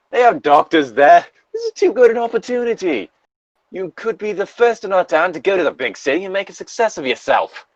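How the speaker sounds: high, insistent put-on voice; fiery redhead. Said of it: "a fiery redhead".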